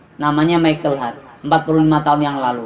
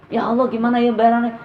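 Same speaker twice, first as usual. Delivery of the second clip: acted worry in a higher voice; anxious fretting; 7 semitones higher than usual. Wife worrying how to pay, anxious fretting. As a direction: fret aloud, pitched up. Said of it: "Itu was-was".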